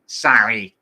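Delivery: yelling. Acted. Yelling at a shopper from four aisles away, mocking yell. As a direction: yell it across the supermarket. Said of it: "from four aisles away yelling".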